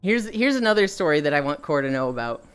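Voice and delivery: ordinary delivery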